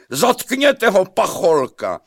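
loud, high-pitched royal command; shouted order. A narrator's reading. King ordering an arrest from his bath, loud cry. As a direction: shout it high, falling at the end. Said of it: "vykřikl Pikola ve vaně".

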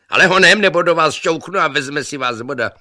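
very loud, high impatient yell; shouted threat. A narrator's reading. Impatient man threatens to push his rival in, yelling. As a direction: hurry them along by yelling. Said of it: "křičeli na sebe a nerozuměli si".

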